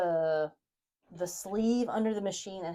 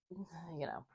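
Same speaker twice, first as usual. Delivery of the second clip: whisper; 3 semitones lower, 17 dB quieter than usual. Real, not acted. Whispered aside while working.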